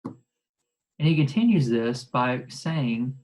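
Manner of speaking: usual speaking voice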